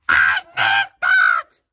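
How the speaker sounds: put-on shrieking falsetto girl; slow, very high, loud squeal; Ami. Ami wanting the box on the shelf, squealed girl voice. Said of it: "stupid voice".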